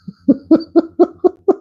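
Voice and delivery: high run of giggles; real laughing fit, high and rippling; helpless giggling. Real, not acted. Host trying to get through the topic, giggling. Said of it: "I shouldn't be laughing".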